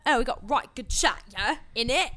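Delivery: playful British slang voice; lightly hammed-up British banter; mock British accent. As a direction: put on a cheeky British accent. Mock British slang while chatting.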